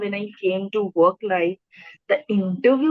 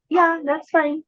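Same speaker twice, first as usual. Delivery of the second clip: higher-pitched, unbothered acted reply; breezy comeback; 7 semitones higher than usual. Answering someone who points out the stammer, breezy comeback. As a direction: brush off the remark lightly.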